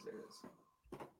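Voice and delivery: breathy whispered echo, slowed right down. Pretend. Finding the fishbowl behind the pizza, a slow whisper. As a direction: hush it to yourself like a secret. Echoes his own find.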